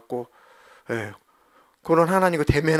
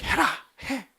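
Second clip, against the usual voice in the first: insistent command; 11 semitones higher than usual. Acted.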